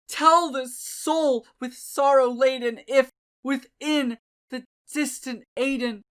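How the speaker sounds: loud, high, building to a shout; desperate pleading. A narrator's reading. Desperate man demanding answers of the raven, building to a shout. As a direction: beg the prophet for an answer. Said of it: "said I".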